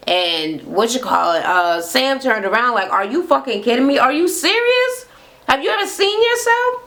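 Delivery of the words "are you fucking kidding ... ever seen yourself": mock disbelief climbing very high; incredulous. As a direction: imitate a stunned friend, pitch soaring on each question. Friend's retold disbelief at insult.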